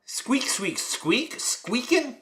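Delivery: high squeaky toy voice; squeaky rubber duck. Acted. Squeak imitation.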